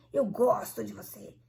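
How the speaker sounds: "gosto" stretched and stressed, tone falling; exaggerated "gosto", then dropping away; altered intonation. Shown as a demonstration. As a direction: lean heavily on "gosto" in a put-on voice. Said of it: "A entonação".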